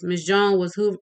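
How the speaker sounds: plain reading voice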